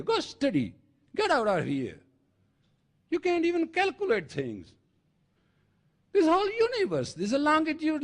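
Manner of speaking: high-pitched, rising harangue; forceful preaching. Real, not acted. Preacher berating listeners to go study and get out, forceful high harangue. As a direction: scold the listeners in a high, insistent voice.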